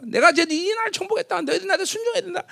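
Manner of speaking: high-pitched mock royal decree; conqueror's proclamation. Preacher plays a conqueror addressing subjects, high proclaiming voice. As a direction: announce the conquest and demand obedience. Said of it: "이렇게 막 선전해야 될 텐데".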